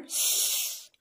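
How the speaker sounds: bare hissed "s", no vowel; weak form of 'is'. Shown as a demonstration. Teacher showing learners the weak form of is, a lone "s". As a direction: hold just the "s" sound. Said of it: "the weak form of is".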